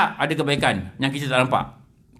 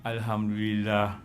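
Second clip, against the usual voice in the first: calm gratitude; 7 semitones lower, 8 dB quieter, slower than usual. Acted. Calm thanks despite angry boss.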